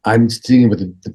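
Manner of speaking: ordinary talk, nothing marked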